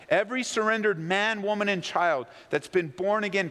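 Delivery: ordinary talk, nothing marked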